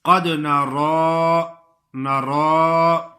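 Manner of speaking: drawn-out recitation of نرا; slow drawn-out demo. Shown as a demonstration. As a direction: stretch out قد نرا slowly, elongated. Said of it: "نعرہ غلط کا لفظ ہے".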